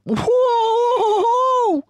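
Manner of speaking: very high, loud shocked cry; gasped whoa. Acted. Smallest fox gulps strong cider, very high gasp. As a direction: yelp from the burn of drink. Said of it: "he gasped".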